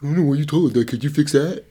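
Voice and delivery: deliberately bad, teasing impersonation; mocking impression of cohost. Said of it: "That's a terrible impression".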